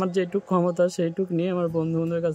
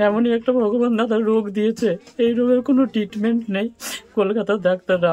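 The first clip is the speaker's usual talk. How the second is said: real anguish, loud and raised; anguished lament; 4 semitones higher, 6 dB louder than usual. Real, not acted. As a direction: lament bitter fate at higher pitch. Laments his incurable illness.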